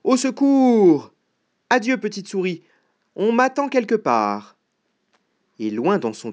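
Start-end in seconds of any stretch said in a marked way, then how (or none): 0.0-1.1 loud, high, drawn-out scream for help; panicked cry. Acted. Fox catching sight of the gruffalo, high panicked cry. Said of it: "il cria". 1.7-4.6 high-pitched flustered farewell; alarmed excuse. Acted. Fox fleeing the gruffalo with an excuse, high and alarmed.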